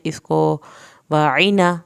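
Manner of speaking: usual speaking voice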